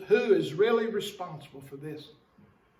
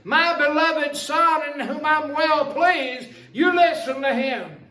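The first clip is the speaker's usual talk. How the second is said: higher, proclaiming sermon voice; preached scripture quote; 5 semitones higher, 4 dB louder than usual. Acted. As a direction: proclaim the voice from heaven.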